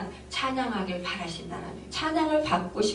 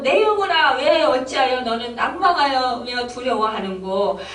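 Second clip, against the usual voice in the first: loud, raised-pitch exhortation; crying out; 5 semitones higher, 4 dB louder than usual. Shown as a demonstration. Preacher urging their own soul to praise God, crying out. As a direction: cry it out to your own soul. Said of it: "이렇게 외치시길 바랍니다".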